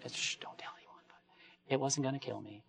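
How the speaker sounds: whispered aside; playful conspiratorial whisper; secret whisper. Pretend. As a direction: hush it like a secret. Confiding aside to the congregation.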